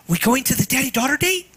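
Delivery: put-on fast, high little-girl voice; dad imitating his little daughter's speed talk; tiny speed talker. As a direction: rattle it off like an excited tiny girl. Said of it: "in her tiny little speed talker voice".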